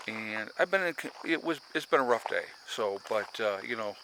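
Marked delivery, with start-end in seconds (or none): none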